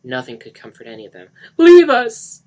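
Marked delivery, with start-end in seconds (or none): 1.6-2.4 high, very loud shout. A narrator's reading. Denny shouting at Mark and Lisa. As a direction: order them out. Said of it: "Denny shouted".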